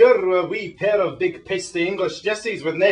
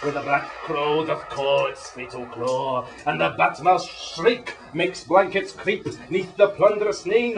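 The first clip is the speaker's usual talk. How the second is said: put-on broad Scottish accent. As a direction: intone it like a Scots bard. Slow Scots poetic recitation.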